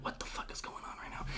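whispered under the breath in disbelief; stunned whisper. Retold or imitated. Clerk whispering to himself as a customer confronts him, stunned whisper. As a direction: mutter it unvoiced, shocked. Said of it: "I'm like".

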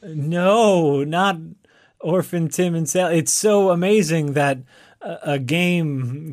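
loud, high mock-horrified protest; mock dismay. Co-host plays along with orphan sob story, mock dismay. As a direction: overdo the horror, pushing key words.

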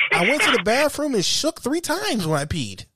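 high, worked-up mock report; acted fed-up recital; exasperated over-explaining. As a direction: spell out every detail in high-pitched exasperation. Acted sarcastic over-detailed answer.

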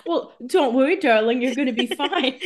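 high, loud playful comforting; hammed-up "darling" reassurance, pitched high; mock reassurance. Mock reassurance to dying character.

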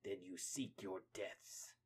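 slow, soft, doom-laden; the Rat King foretelling death, slow and soft; ominous warning. Acted. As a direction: pronounce their doom quietly. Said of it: "Rat King".

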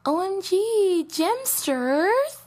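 high, slow, sugary squeal; fake sweet ex. Viewer sliding into the ex's DMs, high sugary. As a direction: coo it up high like a fake friend. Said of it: "sliding up and being like".

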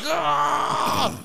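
loud, high mock scream; imitated scream. Brother screaming behind the bathroom door, loud imitated scream. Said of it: "the harder I hear screams".

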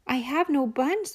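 high, small squirrel voice; nervous squirrel. Acted. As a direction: pipe up like a scared little squirrel. Squirrel replies.

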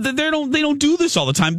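ordinary delivery

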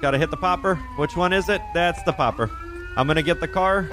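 high, urgent police shouts; shouting commands. Real, not acted. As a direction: yell orders at the suspect. Shouting commands at fleeing suspect.

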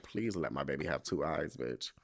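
soft, low pleading prayer; hushed plea. Retold or imitated. Speaker privately begging God, hushed plea.

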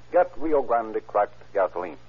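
usual speaking voice

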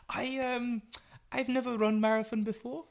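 high, halting innocent voice; high-pitched mock-naive imitation; Emil Zatopek. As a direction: play the naive novice in a loud, high put-on voice. Emil naively questions the rival runner.

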